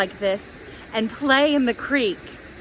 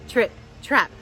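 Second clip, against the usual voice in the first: plain reading voice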